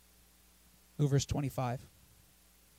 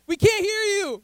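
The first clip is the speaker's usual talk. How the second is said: loud, high jeering call; taunting; 10 semitones higher, 8 dB louder than usual. Acted. Elijah heckles the chanting prophets, loud high taunt. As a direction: jeer it across at them. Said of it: "Elijah just starts taunting them".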